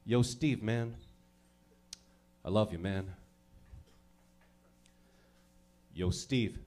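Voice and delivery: low, mumbled guy-to-guy love; deep bro voice. Shown as a demonstration. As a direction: drop the voice deep like a macho bro. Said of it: "Just feel how different that sounds".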